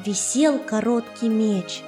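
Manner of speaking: usual speaking voice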